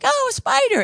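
high, loud excited yelp; kids shouting. Retold or imitated. Kids squealing about a spider to scared dad. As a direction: squeal it like a kid spotting a spider.

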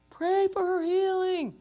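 high-pitched, drawn-out mock family yell; imitated yelling. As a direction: yell it like a pushy, demanding relative. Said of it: "all the family's yelling at me".

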